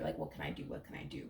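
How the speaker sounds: drops low and quiet into a whisper; hushed, imitated plea; pleading whisper. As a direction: plead under your breath. Quoting partner pleading softly.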